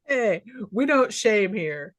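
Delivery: usual speaking voice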